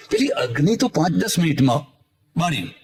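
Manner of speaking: normal talking voice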